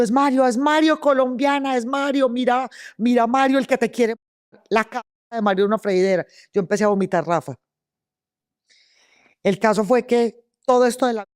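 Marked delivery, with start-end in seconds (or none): 0.0-6.3 high mocking chant of the inmates; taunting inmates. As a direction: jeer at her, repeating the name. (los presos se burlan de ella, voz aguda)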